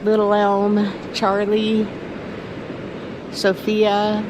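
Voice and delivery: names drawn out slowly, one by one; slow naming. Real, not acted. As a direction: savour each name slowly. Listing her self-given names.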